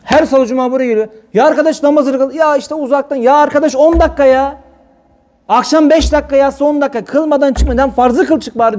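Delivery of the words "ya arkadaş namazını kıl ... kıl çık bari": pleading exhortation. Retold or imitated. Urging congregants to pray.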